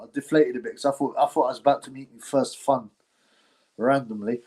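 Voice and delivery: normal talking voice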